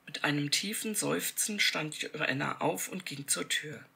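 ordinary delivery

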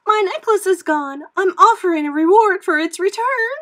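very high, loud, frantic woman; distraught cry. A narrator's reading. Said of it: "she cried".

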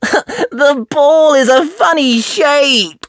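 put-on high, slow little girl voice; a small piggy complaining; Peppa piglet voice. Peppa complaining to friends about the noisy daddies.

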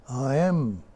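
a slow, drawn-out "I am" with each word stretched; said slowly. Shown as a demonstration. Teacher showing how the mantra "I am" is said slowly. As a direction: let "I am" unfold slowly, like a mantra. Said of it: "When you say it slowly".

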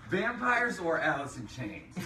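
laughing through the question; giggling while asking. Real, not acted.

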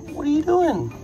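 very high squeaky pet voice. Real, not acted. Cooing at the crocodile.

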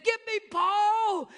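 put-on high, loud, slow child voice; high pleading kid's cry; little boy's plea. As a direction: call out like a scared giggling toddler. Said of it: "and he said".

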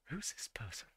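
hushed unvoiced whisper. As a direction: breathe it like a secret aside. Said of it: "Be like".

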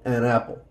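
An apple linked smoothly into one word. Shown as a demonstration. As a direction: join An to apple with no break. (junto: An apple en una sola palabra)

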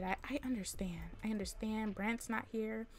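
soft whispered understanding; whispering calm to the sim; whispered reassurance. Pretend. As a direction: hush her gently. Soothing the sim in labor.

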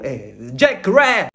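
jacaré bent into English, "jake-RAIR", slow and low; drawn-out mock English accent on jacaré; improvised English accent. Swimmer improvising English for a friend, jacaré in a fake English accent. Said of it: "Improvisei e falei assim".

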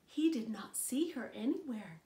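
ordinary delivery

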